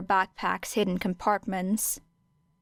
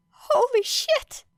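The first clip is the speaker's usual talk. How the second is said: shocked exclamation; slower than usual. A narrator's reading. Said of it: "muttered Kaminari hoarsely".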